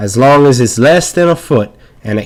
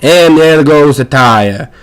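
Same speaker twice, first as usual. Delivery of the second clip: normal talking voice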